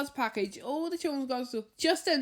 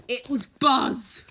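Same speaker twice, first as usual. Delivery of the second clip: slow, much louder reveal of the name; excited reveal; 9 dB louder, much slower than usual. A narrator's reading. Narrator reveals Buzz has returned, slow and loud. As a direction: land the surprise big.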